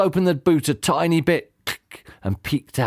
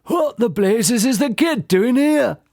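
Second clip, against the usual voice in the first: shouting; 9 semitones higher, slower than usual. A narrator's reading. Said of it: "Fingers shouted".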